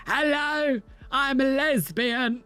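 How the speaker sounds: high, drawn-out mock introduction; mock announcing voice. Reacting to the video, high mock announcing voice. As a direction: announce it slowly, high and silly.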